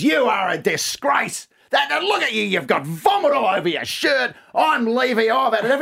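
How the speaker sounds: put-on wife scolding her drunk husband at the door; angry wife. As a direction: tear into him as the fed-up wife. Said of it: "She goes".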